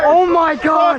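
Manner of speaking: high, loud, ecstatic yells; excited shouting. Real, not acted. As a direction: scream with pure joy. Huge fish landed, screaming.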